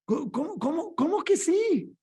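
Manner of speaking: pitched well up in disbelief; can't believe what he hears; incredulous. Real, not acted. Pastor questioning the yes-men, incredulous and high.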